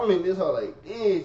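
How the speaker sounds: ordinary talk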